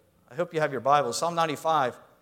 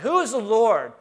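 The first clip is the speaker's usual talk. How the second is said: loud, higher contemptuous taunt; scornful Pharaoh; 5 semitones higher, 6 dB louder than usual. Retold or imitated. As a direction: look down on the slaves and mock. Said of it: "The world says that".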